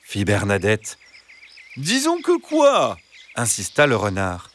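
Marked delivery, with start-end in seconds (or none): 1.8-3.0 high, slow pressing fox voice; high insistent fox. A narrator's reading. Fox pressing the stammering hen, high and sly. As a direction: push her to finish, sweetly.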